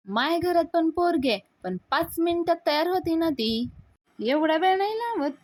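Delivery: high-pitched, defensive comparison; indignant retort. Acted. Sulakshana defends herself over the late bride, indignant retort. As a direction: argue back, affronted. Sulakshana answering back, acted quarrel.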